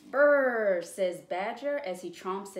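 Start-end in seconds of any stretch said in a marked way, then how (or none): 0.0-0.8 loud shivering brr; cold shiver. Acted. Badger comes in from cold.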